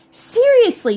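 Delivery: high, loud "seriously"; a burst of disbelief; loud insistence. A narrator's reading. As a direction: push the word out hard. Dad insists.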